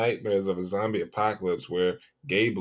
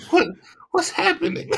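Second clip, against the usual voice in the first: confused; 5 dB louder than usual. Real, not acted. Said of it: "I'm just confused".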